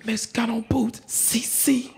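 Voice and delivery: urgent stage-whisper call; whisper-shout for someone you can't find; hushed, searching call. Acted. Hunting for Cece around the unit.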